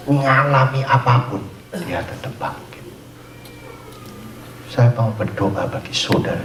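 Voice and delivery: ordinary talk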